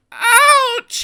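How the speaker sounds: very high yelp of pain; jolted cry. A narrator's reading. Peter Sam yelping as the crash throws him back. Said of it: "he shivered".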